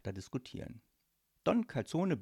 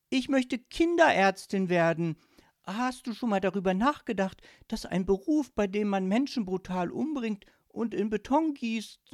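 put-on high, loud, protesting girl; exasperated daughter; 9 semitones higher, 6 dB louder than usual. Daughter asks papa for a normal job, exasperated. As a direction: challenge papa in a high insistent voice. Daughter confronts her father.

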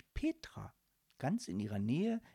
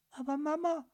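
put-on higher child's protest; protesting little girl; 12 semitones higher than usual. Daughter protesting to her mother, high and pleading. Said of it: "rief Anne".

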